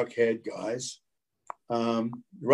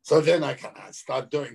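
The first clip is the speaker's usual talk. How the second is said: ordinary talk, nothing marked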